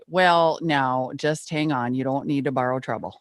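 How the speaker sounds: slow, folksy North Dakota drawl, lightly done; a patient Plains grandma easing a worried child, North Dakota accent; gentle Midwestern grandmother telling you to calm down, North Dakota accent; grandma Pat's North Dakota accent. Retold or imitated. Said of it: "my grandma Pat would say".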